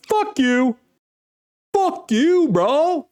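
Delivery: hammed-up high-pitched kiss-off; mock angry breakup yell. Mocking angry ex's retort.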